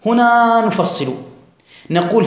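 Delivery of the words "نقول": ordinary talk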